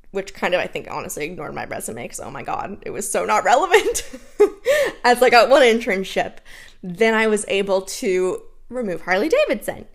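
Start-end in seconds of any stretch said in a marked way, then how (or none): 4.4-5.0 high giggling laugh; burst of laughter. Real, not acted. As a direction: laugh out loud. Laughing at own resume.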